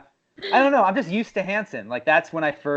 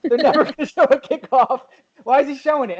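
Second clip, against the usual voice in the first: higher, half-laughing fan; a fan who can't believe the TV is showing a kickoff; excited disbelief; 9 semitones higher, 3 dB louder than usual. Retold or imitated. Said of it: "You're like".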